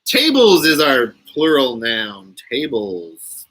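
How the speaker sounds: usual speaking voice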